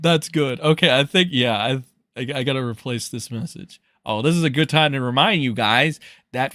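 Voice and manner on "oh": normal talking voice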